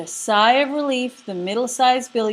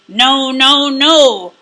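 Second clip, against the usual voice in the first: very loud, higher troll shout; troll refusing to let him pass; 4 semitones higher, 11 dB louder, much slower than usual. Acted. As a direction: roar each no like a troll. Troll refuses the big goat.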